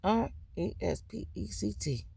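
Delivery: R E S P E C T spelled letter by letter, softly. Shown as a demonstration. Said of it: "in all capital".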